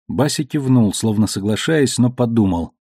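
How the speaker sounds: usual speaking voice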